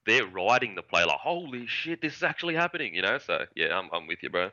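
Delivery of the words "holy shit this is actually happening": hushed-volume, high-pitched retold amazement; imitating excited commentator. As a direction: lift it like a commentator realising mid-play. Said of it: "hear in the commentator's voice".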